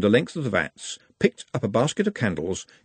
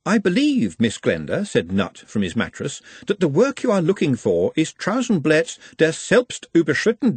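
lightly voiced, precise scholarly lecture; Nutt's voice; 6 semitones higher than usual. A narrator's reading. Nutt correcting Glenda from his mattress, in a prim lecturing voice. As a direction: recite it like a pedantic scholar.

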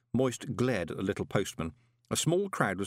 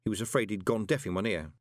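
plain reading voice

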